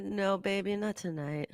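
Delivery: seductive voice. Pretend. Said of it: "people, I don't talk like that".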